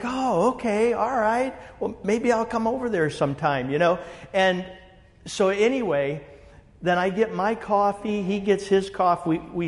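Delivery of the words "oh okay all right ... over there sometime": higher, breezy casual agreement; friendly interest. Retold or imitated. BMW guy hearing the church name, lightly voiced breezy reply. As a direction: shrug it off, pleasantly. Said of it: "And he's like".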